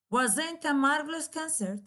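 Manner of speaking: "wasn't a marvelous concert?" said high, falling at the end; exclamatory falling tune. Shown as a demonstration. Teacher gives a second exclamatory example, falling tune. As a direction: sound delighted and let it fall. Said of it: "there will be a falling instead of rising intonation".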